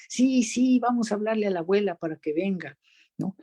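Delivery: put-on high, quick, happy baby-talk reply; mother answering for baby. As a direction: chirp the answer for the little one. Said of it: "tomar los dos turnos".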